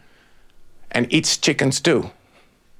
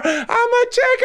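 much higher, shrieked cry; panicked shout. A narrator's reading. Chicken falling through the air, shrieking. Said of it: "it started to scream".

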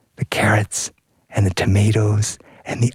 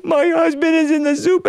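high comic wail; hammed-up tearful lament; mock crying. Wife telling the rooster about her husband, high comic wail. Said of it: "why is everybody crying?".

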